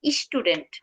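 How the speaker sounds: স্টুডেন্ট as "ish-tu-dent", plain oo; common mispronunciation. Shown as a demonstration. Teacher showing what we wrongly say, mispronounced "ish-tudent". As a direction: say স্টুডেন্ট with a leading i and no y-glide. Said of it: "এটা রং pronunciation".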